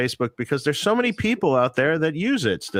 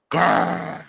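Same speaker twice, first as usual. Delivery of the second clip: playful growl; 8 semitones higher than usual. Retold or imitated. Imitating a heavy aggressive tone.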